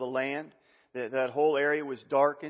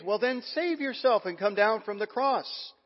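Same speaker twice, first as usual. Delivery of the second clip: high, sneering taunt; mocking crowd; 8 semitones higher than usual. Passersby taunting Jesus on the cross, high sneering voice. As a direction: taunt him, dare him to come down.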